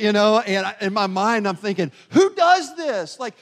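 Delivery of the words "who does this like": loud, much higher indignant outburst; exasperated rant. Retold or imitated. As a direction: vent at the driver cutting in, loud and high. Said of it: "as I'm getting all worked up".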